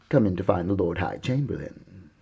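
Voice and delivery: ordinary delivery, nothing marked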